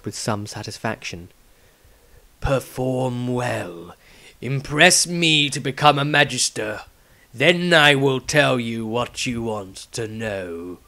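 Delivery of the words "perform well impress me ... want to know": very slow, grave elder's voice; the patriarch challenging Maestro, slow and grave; stern old patriarch. Acted. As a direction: weigh every word like a judge. Patriarch speaks.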